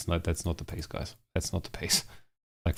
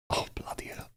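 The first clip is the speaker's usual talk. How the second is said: a breathless under-the-breath curse; whisper; 12 dB quieter than usual. Real, not acted. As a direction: whisper it to yourself. Throat dead, drops to whisper.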